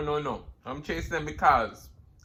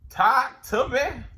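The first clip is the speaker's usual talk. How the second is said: slow, high and louder plea; drawn-out emphatic repeat; 6 semitones higher, 4 dB louder, much slower than usual. Real, not acted. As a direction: stretch it out, pleading.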